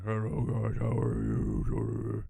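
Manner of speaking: slow, mock deep-voiced greeting; put-on deep voice. Shown as a demonstration. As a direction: drag out a hammed-up low "Hello guys". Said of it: "trying not to make it go like".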